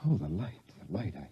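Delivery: soft, spent gasp of relief; barely voiced, shaken; breathless relief. Man seeing light again after darkness, a soft shaken gasp.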